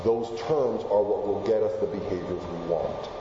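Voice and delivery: ordinary talk, nothing marked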